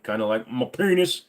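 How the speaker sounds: ordinary talk, nothing marked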